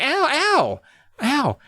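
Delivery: high, squealed ows; mock pained yelps. Girl hit by tiny arrows, high mock yelps. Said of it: "she's like".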